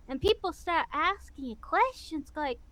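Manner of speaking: ordinary delivery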